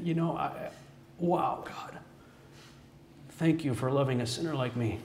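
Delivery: soft, awed thanks; hushed prayer. Retold or imitated. The speaker thanks God in prayer, hushed and awed. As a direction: murmur it to God in wonder.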